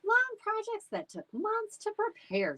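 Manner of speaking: high, far-off swooning tone; dreamy about old projects; gushing mom. A narrator's reading. Mom gushing to the family about projects, high and dreamy.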